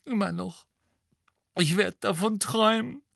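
high, tearful moan. A narrator's reading. Mrs Weasley moaning to Lupin about her nightmares. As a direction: keep moaning, worn out with grief. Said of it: "stöhnte Misses Weasley".

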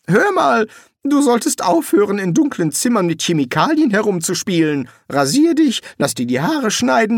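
high, brisk advice; wizard voice. Acted. Rincewind lectures the summoner on grooming, high and brisk. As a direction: tell him off, fast and eager.

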